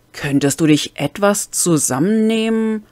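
a female enforcer snaps at the armed man to rein himself in, hissing; hissing at him. A narrator's reading. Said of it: "fauchte Xex ihn an".

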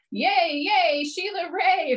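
high, slow cheering yell; mock cheer. Friends cheering for Sheila Wray, high drawn-out cheer. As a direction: cheer her on like a crowd of kids. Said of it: "her friends yelled".

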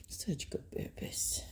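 whispered, almost silent. Real, not acted. Owner whispering praise to her dogs, almost silent. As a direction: barely breathe the words.

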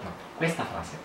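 stage whisper. Real, not acted. Mouthing phrase for lip-reading.

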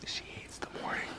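soft unvoiced whisper; whisper, don't wake her. Real, not acted. Partner sleeping nearby.

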